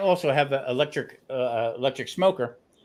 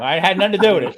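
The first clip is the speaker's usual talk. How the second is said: put-on tough-guy Jersey accent, louder; 4 semitones higher, 8 dB louder, faster than usual. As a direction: deny it like a Jersey wiseguy. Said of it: "Says in his Jersey accent".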